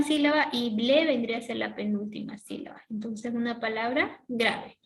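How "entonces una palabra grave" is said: normal talking voice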